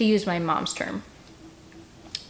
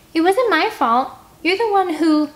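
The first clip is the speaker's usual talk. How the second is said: angry protest; 10 semitones higher, 6 dB louder than usual. Acted. Scarlet arguing with Finn, high indignant protest. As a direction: fire back defensively.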